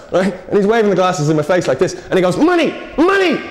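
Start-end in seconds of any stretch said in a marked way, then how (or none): 2.3-3.4 high, drawn-out mock mugger demand. Mugger waving stolen glasses at his victim, high mock demand. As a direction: imitate a mugger crying out for money.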